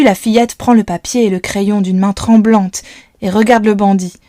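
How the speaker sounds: ordinary delivery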